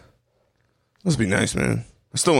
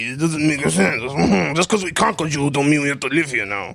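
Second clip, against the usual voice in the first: usual speaking voice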